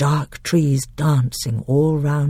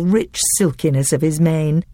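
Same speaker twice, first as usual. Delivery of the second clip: usual speaking voice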